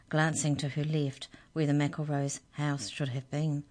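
ordinary delivery, nothing marked

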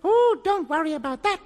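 high, small goblin voice; little goblin. A narrator's reading. Goblin introducing himself to the children, little high voice. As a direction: introduce yourself in a tiny high voice. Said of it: "said Binklebonk".

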